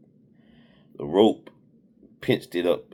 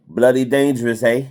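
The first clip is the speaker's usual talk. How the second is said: loud, high mock Australian accent; put-on Aussie accent, pitched up; British-Australian accent; 7 semitones higher, 10 dB louder than usual. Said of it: "That's my British, Australian accent".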